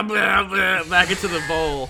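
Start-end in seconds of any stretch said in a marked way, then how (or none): 0.0-0.8 loud fake vomiting sounds; mock retching. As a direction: retch it out, hammed-up. Acting out vomiting into bowl.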